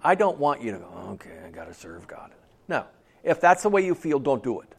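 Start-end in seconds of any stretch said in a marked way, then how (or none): 1.1-2.3 very low, very soft, reluctant mumble; grudging resignation. Acted. Joyless believer accepting a duty, grudging and subdued. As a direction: drop it like a dreary obligation.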